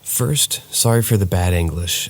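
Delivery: ordinary delivery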